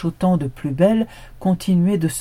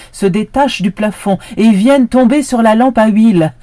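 loud, urgent narration of the fire; rising alarm; 7 semitones higher, 11 dB louder than usual. A narrator's reading. Narrator tells the listeners the fire starts, loud and urgent. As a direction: sound the alarm as it catches. Disaster begins.

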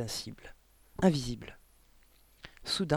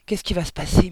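ordinary delivery, nothing marked

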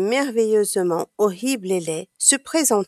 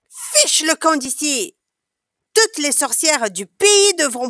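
higher, scolding queen; lightly voiced queen sending the sorcerer away; a queen's haughty scolding, voiced higher; indignant queen; 6 semitones higher than usual. A narrator's reading.

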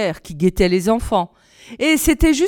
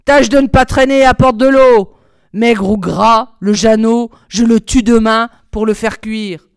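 louder, stern commands; impatient orders; 7 dB louder than usual. A narrator's reading. Impatient witch ordering Margot to fetch water, loud and stern. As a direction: bark the orders, done waiting. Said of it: "la vieille s'impatienta".

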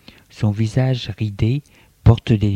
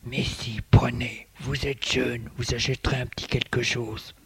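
put-on soft old man voice; 9 dB quieter than usual. A narrator's reading. Old man insisting, offering envelope.

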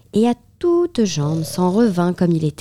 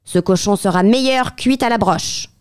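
bossy giantess; 4 semitones higher than usual. A narrator's reading. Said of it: "dit la géante".

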